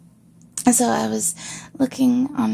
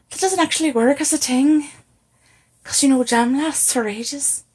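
put-on louder Irish accent, protesting; Roy, Irish accent; 2 semitones higher, 6 dB louder than usual. Quoting a sitcom character while improvising Irish, loud Irish accent. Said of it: "it reminds me of, uh, the I T crowd, Roy".

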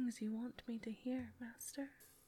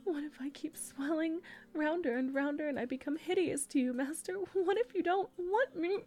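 louder, higher, rising panic; anxious pleading; 4 semitones higher, 7 dB louder than usual. Acted. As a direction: plead harder and harder, frightened.

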